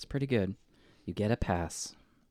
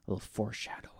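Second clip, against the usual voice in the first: whisper; 10 dB quieter, faster than usual. Real, not acted. Ominous aside about the puffer.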